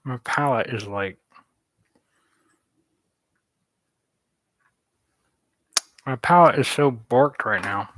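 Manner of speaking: talking around a paintbrush in teeth; words muffled by a brush held in mouth. Real, not acted. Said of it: "I got, like, my brush in my mouth".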